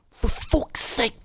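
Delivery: high, drawn-out strained whispery squeal; slow high airy exclamation; Torgy Porgy. Acted. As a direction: squeeze out an exasperated high rasp. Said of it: "I'm talking like Torgy Porgy".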